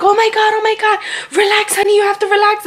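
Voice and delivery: high, urgent imitation of nurses; high-pitched, hurried retelling; flustered staff. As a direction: act out flustered staff urging calm. Acts out staff reacting to panic.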